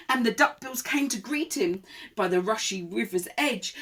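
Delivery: lively, projected read-aloud; rhythmic storybook telling; children's story narration. A narrator's reading. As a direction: read it to small children with relish. Reads a picture book.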